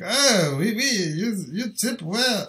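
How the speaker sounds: put-on botched French accent, nothing like France; mock French accent. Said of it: "I don't know what kind of fucking accent that was".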